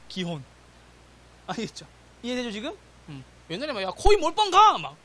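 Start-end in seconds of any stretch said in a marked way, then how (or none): 3.8-4.8 high, loud reckless urging; re-enacting his old bold self, high and loud; gung-ho shout. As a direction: shout it like an all-in gambler.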